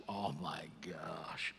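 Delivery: slow, breathless whispered sigh; sigh of gratitude. Retold or imitated. Walking around after prayer, sighing oh my gosh in gratitude. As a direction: sigh it out in wonder. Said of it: "involuntary sighs of gratitude".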